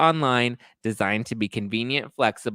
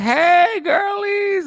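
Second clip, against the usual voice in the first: put-on squealed drunk hello, much higher and louder; drunk slurring greeting; 8 dB louder, much slower than usual. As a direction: play the tipsy arrival, shrieking the greeting. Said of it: "come slurring on in".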